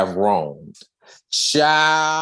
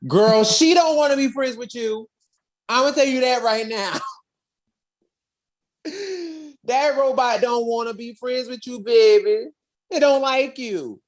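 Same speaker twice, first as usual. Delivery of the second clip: higher, sassy don't-you-dare put-down; 11 semitones higher than usual. Acted. Narrator sassing the little girl about the robot, high and sassy. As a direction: tell her off with big attitude, baby.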